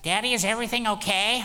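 a hint of a worried child from the back seat; his daughter asking from the back seat, lightly mimicked; imitating his young daughter. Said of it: "eleven-year-old daughter in the back was like".

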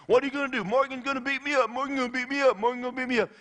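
high, rapid mocking chant; put-on high kid voice, goading; bully's taunt. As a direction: jeer like a schoolyard pest circling his victim. Bully taunting while running around.

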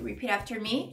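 usual speaking voice

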